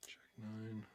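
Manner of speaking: whispered under his breath. Real, not acted. Streamer whispering his hand to himself. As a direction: breathe the cards out to yourself. Muttering to himself at poker table.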